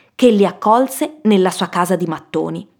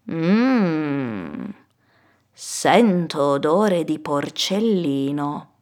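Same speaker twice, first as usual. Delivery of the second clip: very slow, soft wolf voice; prowling wolf, hushed and drawn out; hungry wolf; 3 semitones lower, 5 dB quieter, much slower than usual. Acted. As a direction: savour the scent, drawing out every word. Hungry wolf sniffs out a pig.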